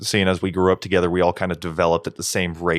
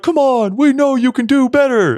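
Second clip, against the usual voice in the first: very high, loud stage rallying call; mock frontman hype; 5 dB louder than usual. Podcaster mocks a frontman goading the crowd, loud high hype. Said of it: "they're like".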